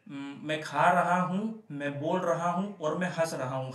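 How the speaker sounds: slow, careful demo of each example sentence. Shown as a demonstration. Teacher acting out examples to students, slow and deliberate. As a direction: spell the examples out slowly for the class.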